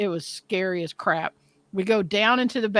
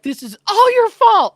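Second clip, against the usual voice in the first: much higher and louder, yelling the blame; furious yell; 13 semitones higher, 9 dB louder than usual. Retold or imitated. Wife yelling blame at her husband, furious yell. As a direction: scream it at him, fully enraged. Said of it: "I was so pissed".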